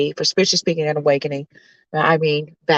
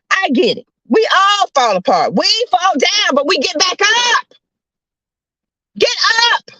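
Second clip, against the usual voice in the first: preacher's hollering; 13 semitones higher, 3 dB louder than usual. Real, not acted. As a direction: holler them back onto their feet. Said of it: "I'm gonna stop hollering".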